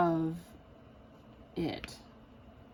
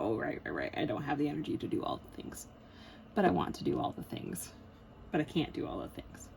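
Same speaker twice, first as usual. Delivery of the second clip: lower, deflated giving-in; mock resignation; 4 semitones lower than usual. She concedes to her depression, mildly defeated. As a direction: give in meekly, trailing off. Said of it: "And then I go".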